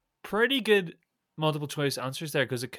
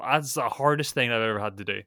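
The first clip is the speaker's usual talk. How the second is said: ordinary talk, nothing marked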